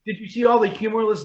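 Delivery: ordinary talk, nothing marked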